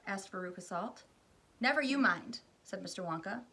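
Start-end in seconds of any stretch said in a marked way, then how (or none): 1.6-2.4 teasing dismissal. Acted. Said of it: "Wonka teasing".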